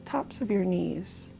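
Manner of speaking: soft, unhurried body-scan voice with long pauses; guided meditation. Real, not acted. Leading listeners through a body scan from toes to legs, guided meditation. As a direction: gently guide them through the body, calm and quiet.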